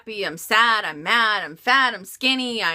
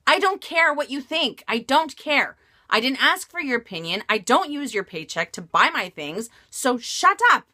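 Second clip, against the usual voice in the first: louder, higher angry shout; retold outburst; 6 semitones higher, 5 dB louder than usual. Telling the nagging coworker to shut up, loud and angry. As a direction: snap back in fed-up anger. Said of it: "I just had it and I said".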